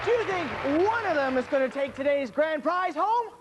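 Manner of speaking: high, bright host opener; excited game show host. Acted. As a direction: pump up the crowd.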